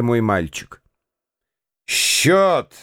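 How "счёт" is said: mild angry bark for the bill; grated out. A narrator's reading. Lambert to the servants, a curt angry call. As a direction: snap the one word at the waiter. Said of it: "проскрежетал Ламберт прислуге".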